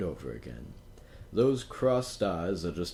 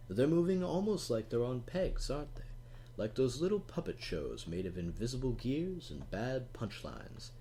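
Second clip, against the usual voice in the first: plain reading voice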